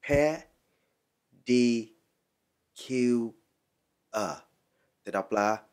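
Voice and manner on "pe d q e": "Pe- D Q E" with lips pressed for P; syllable by syllable. Shown as a demonstration. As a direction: break pedicure into separate, held syllables. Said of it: "Phải bặm môi lại nha".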